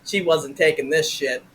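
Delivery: normal talking voice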